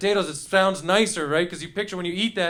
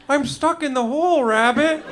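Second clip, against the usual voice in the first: lightly voiced bear, higher and louder; drunk teddy bear; 7 semitones higher, 4 dB louder than usual. Beer-fed teddy bear to rabbit, playful higher call. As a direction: play a tipsy cartoon bear calling out. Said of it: "teddy bear that found beer".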